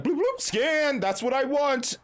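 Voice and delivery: high-pitched cartoon bloops, then singsong order; playful beeping demo. Customer scanning phone at automated Burger King, playful. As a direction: chirp it like a scanner.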